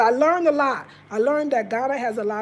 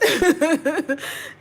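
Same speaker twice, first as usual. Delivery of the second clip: high, rapid run of giggles; laugh; 3 semitones higher than usual. Real, not acted. Laughing about the good time with family, a high rippling laugh. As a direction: burst into a quick string of laughs. Laughs after her own exclamation.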